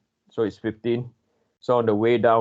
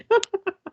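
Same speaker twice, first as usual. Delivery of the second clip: high laugh. Real, not acted. Laughing at Peppa Pig memory.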